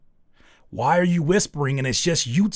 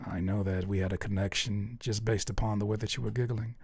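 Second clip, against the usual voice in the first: low, soft parody of a creepy narrator; whispering voice; 3 semitones lower, 8 dB quieter than usual. Acted. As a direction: murmur it like a secret admirer watching, with pauses. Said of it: "continue to speak in this whispering voice".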